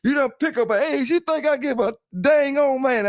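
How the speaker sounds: high mocking voice, mimicking; squeaky imitation of the boys who diss him; taunting imitation of a rival breeder; mocking the critics. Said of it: "Them boys done say".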